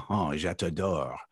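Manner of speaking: a soft French accent; lightly French accent, showing off a bit of French. Shown as a demonstration. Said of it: "Or if we talk the French".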